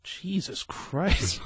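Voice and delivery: slow, hushed disbelief; uneasy reaction. Acted. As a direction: say it under your breath, drawn out. Acted uneasy crowd reaction.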